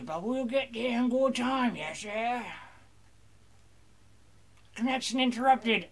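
playful pirate banter over the game; mock sea-dog voice. Gamer plays a pirate character.

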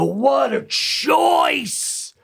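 high, drawn-out exclamation; hugely drawn-out mock awe; mock amazement. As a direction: stretch it out in a high, marvelling shout. Mock amazement at actor's accent choice.

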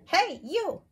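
high, loud, cheeky challenge; grouchy ladybug voice. Acted. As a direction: pick a fight in a squeaky bug voice. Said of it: "said the grouchy ladybug".

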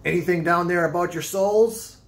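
put-on high, loud, ominous old seaman; eerie old sailor. A narrator's reading. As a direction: croak it like a half-mad sailor. Stranger figure voiced.

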